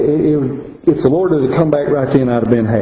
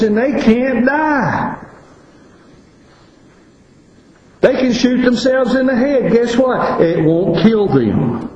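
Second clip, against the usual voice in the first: raised, higher pitched preaching; preaching at full force; 6 semitones higher than usual. Acted. Preacher warns congregation of unending pain, raised preaching. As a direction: press the warning home from the pulpit.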